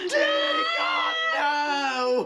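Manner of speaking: hammed-up wailing cry, high and loud; over the top crying. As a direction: wail it like a soap opera. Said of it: "over the top dramatic crying scene".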